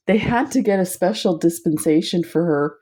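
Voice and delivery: normal talking voice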